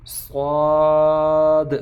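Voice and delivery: صاد slow, full-mouthed and loud; heavy saad. Shown as a demonstration. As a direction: fill the mouth and push out صاد. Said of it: "موٹا پڑھیں گے".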